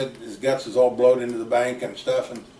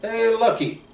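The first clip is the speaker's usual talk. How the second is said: a retold call, pitched well higher and louder; 10 semitones higher, 5 dB louder, slower than usual. Dad spotting his old friend on the hill, a higher, louder call. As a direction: hail a buddy from across the hill. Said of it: "I heard this voice go".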